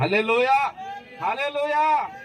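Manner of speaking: high praise cry to the congregation; ringing pulpit hallelujah. Real, not acted. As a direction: call it out, lifting the pitch. Preacher calls out hallelujah to congregation.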